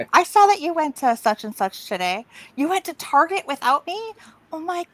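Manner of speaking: high, hammed-up exasperation; mock exasperated mom. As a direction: whine it like a mom caught out. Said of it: "stalks me".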